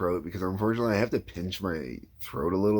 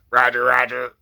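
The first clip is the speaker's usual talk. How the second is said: louder clipped droid voice; battle droid; 5 semitones higher, 8 dB louder than usual. Shown as a demonstration. Droid catchphrase as a warm-up for viewers. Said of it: "the key thing is always".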